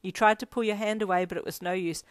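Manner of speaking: plain reading voice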